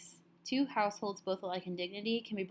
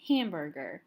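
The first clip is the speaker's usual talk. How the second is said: hamburger, leaning on the opening HAM; stress on first syllable; 6 dB louder than usual. Shown as a demonstration. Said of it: "the stress is on this first syllable".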